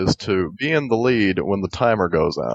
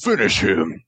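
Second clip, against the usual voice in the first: Mortal Kombat announcer. Retold or imitated. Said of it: "Get that dude from, like, Mortal Kombat".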